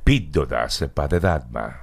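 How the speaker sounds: low, slow radio announcer; radio host voice. Shown as a demonstration. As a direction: present it like a late-night radio slot. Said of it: "Estás presentando una sección en radio".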